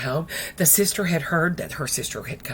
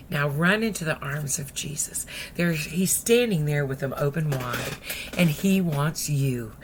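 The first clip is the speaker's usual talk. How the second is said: ordinary talk, nothing marked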